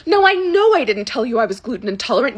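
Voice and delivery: usual speaking voice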